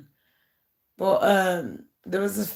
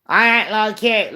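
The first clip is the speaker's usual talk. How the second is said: louder, scornful mock line; mocking quote; 3 semitones higher, 6 dB louder than usual. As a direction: throw her words back, sneering. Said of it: "she's making out like I'm desperado".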